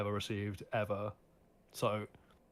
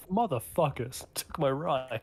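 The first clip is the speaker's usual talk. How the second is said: louder, higher indignant outburst; hammed-up aggrieved exclamation; mock outrage; 4 semitones higher, 4 dB louder, much slower than usual. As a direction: play someone indignant at being robbed. Voices character's outrage at stolen ship.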